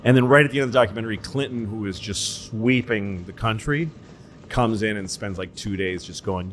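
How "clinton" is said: ordinary talk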